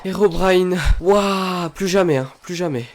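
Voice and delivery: high, loud terrified yelling; scared horror game streamer. Real, not acted. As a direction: scream after a jumpscare. Jumpscare hits the streamer.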